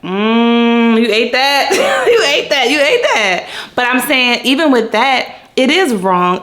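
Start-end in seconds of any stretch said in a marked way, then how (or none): 0.0-3.5 high, delighted mock praise with a laugh; impressed reaction. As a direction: gush over it, half laughing. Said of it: "And I was like".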